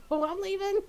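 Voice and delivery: high, fed-up announcement; mock storming off. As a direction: play a sulky exit. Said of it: "Golden's like".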